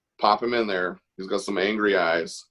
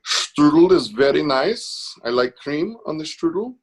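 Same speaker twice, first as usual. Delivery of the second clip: slow, high-pitched German accent; 8 semitones higher, 4 dB louder, slower than usual. Shown as a demonstration. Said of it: "as good as it gets on the German".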